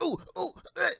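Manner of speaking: put-on high, hand-waving kid begging to answer; eager third grader. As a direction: squeak like a child dying to be picked. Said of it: "a third grader trying to answer".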